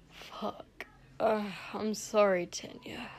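weak, breathy hungover croak. A narrator's reading. Sick prince apologizing to his servant, croaking. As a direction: croak it out very softly. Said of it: "Shoto croaked".

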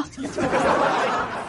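a short, soft laugh at her own joke. Real, not acted. Comedian laughing at her own punchline. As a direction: let out a quick, quiet giggle.

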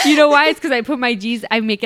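laughing out the excuse. Real, not acted. Explaining her bad handwriting to friend, loud laughing. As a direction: blurt it loud through laughter.

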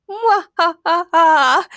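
slow high villain cackle; evil cackle. A narrator's reading. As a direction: cackle like a witch. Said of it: "cackled a strange lady".

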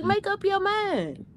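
exasperated. Real, not acted. Complaining about men's mixed signals.

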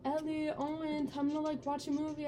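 lightly voiced grandma, soft and high; grandma calling. Grandma calls kids to watch a movie, gentle call. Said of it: "my grandma's like".

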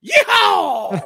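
loud high cowboy whoop; yeehaw. Pretend. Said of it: "you forgot to go yeehaw".

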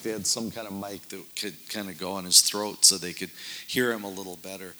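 usual speaking voice

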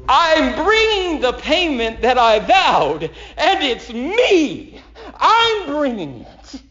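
high, ringing preacher's cry; fervent climax. Acted. Preacher to congregation, crying out the vow in high fervor. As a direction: lift it up as a joyful vow.

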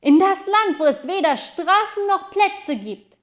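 high, loud shout; boy shouting down to the park, loud and high. Acted. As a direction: yell it down from the goose. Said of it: "schrie der Junge".

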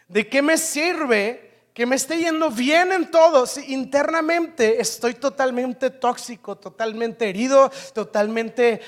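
slow, rising, building sermon; impassioned preaching. Acted. Pastor pressing the congregation about inner health, intense.

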